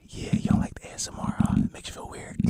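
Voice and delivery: teasing unvoiced ASMR whisper; mock ASMR whisper, playfully petty. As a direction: whisper it close to mess with the viewers. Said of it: "I was feeling real petty".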